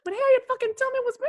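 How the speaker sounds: cartoonish high-pitched rant; mock diva voice. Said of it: "that's how you was talking".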